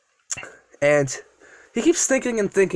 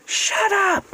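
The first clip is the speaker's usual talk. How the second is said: high-pitched angry cry; acted angry outburst. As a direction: cut the villain off, pitch leaping up. Captive hero's angry outburst.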